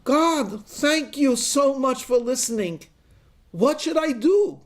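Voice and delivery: high, excited gratitude; grateful and breathless, asking for orders; relieved, eager plea. Acted. Hiker thanking God who answered, eager and high.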